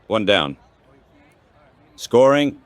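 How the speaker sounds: ordinary talk, nothing marked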